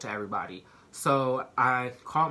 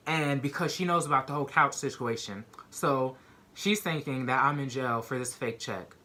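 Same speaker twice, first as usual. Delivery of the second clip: ordinary delivery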